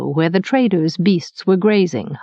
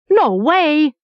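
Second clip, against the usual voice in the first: higher, indignant refusal; 7 semitones higher than usual. A narrator's reading. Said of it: "Pimer was flatly indignant".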